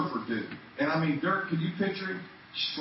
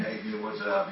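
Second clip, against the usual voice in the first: high, breezy surfer-dude hello; laid-back surfer greeting; 8 semitones higher than usual. Retold or imitated. Surfer kid greeting the church mid-worship, high and breezy. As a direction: toss it off like a barefoot surfer. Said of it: "he said".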